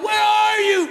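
high, drawn-out mock shout; guy calling out. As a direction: call across open water in hammed-up desperation. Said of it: "you hear the guy go".

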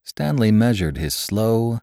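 ordinary delivery

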